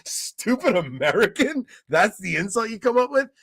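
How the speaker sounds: laughing in disbelief, a touch high and loud; mocking laugh. To the driver who insulted him, laughing mockery. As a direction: scoff at the weak insult. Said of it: "I'm like".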